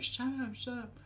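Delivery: high-pitched yell held back in volume; mock screaming. Whole alleyway yelling at the screamers, mock screaming. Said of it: "like, screaming".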